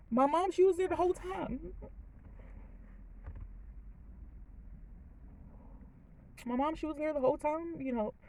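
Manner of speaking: choked up and apologetic; crying. Real, not acted. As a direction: fight back real tears while talking. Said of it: "I was not gonna cry".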